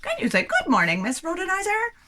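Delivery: high, goofy greeting; silly voice. Shown as a demonstration. Demo of silly voice among five.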